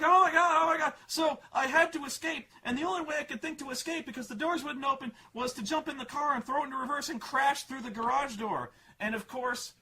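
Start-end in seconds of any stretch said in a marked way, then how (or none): 0.0-8.7 put-on high, loud frantic girl voice; panicked girl. Daughter explaining the crash to dad, high frantic mock voice. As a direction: rush it out like a scared teen. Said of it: "She's like".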